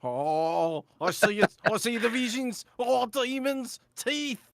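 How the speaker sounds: high, loud panicked cries at the visions; loud, high terror building from oh to God; terrified visions. Acted. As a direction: gasp out each horror rising in pitch. Rufus assaulted by demon visions.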